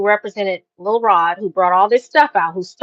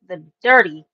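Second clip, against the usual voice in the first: normal talking voice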